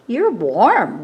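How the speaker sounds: ordinary delivery, nothing marked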